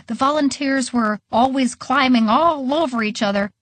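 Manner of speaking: ordinary delivery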